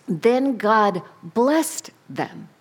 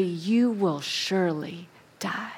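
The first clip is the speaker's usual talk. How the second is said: hushed whispered warning; 4 semitones lower, 8 dB quieter than usual. Acted. God warning Adam about the tree, whispered. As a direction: breathe the warning softly.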